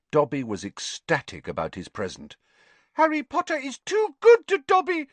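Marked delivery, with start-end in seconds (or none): 2.9-5.1 high, squeaky little elf voice, louder; squeaky house elf. Acted. House elf Dobby squeaks his thanks to Harry. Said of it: "he squeaked".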